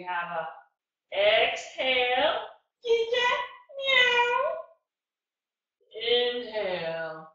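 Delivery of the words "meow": high playful meow; cat meow. Pretend. Teacher meows high during cat pose. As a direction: squeak it like a kitten. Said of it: "That's what a cat says".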